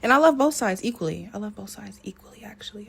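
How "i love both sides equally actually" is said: hushed, much softer whisper; whispered aside. Real, not acted. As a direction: drop to a confiding whisper. Repeats the claim as whispered aside.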